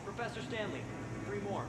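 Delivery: a faint interruption from the back of the dome; off-mic colleague. Acted. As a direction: call it in from far off the mic. Colleague interrupts from across room.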